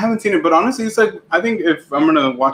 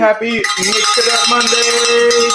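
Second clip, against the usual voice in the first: hype greeting; 6 semitones higher, slower than usual. Real, not acted. Streamer opening hype greeting.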